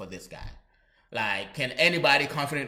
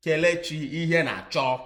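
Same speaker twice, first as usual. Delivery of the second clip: Iheanacho said the Igbo way, rising at the end; Igbo accent; 3 semitones higher, 5 dB louder than usual. Shown as a demonstration. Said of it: "I'm gonna say his name right with the Igbo accent".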